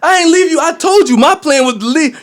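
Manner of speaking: half-acted shouting match; retold heated reply. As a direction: snap back defending yourself.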